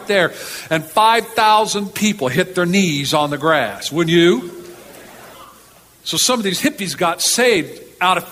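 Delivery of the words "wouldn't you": ordinary talk, nothing marked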